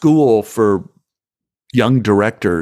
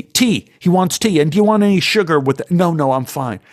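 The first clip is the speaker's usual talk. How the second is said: higher, fast officious voice; bossy assistant director; 7 semitones higher, faster than usual. Retold or imitated. Assistant director ordering the boy around, officious and fast. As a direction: play the pushy set boss. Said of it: "he goes".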